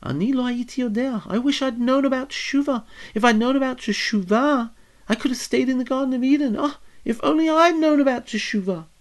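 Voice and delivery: high, wistful lament of a man full of regret; Adam sighing over what he missed. Acted. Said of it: "says Adam".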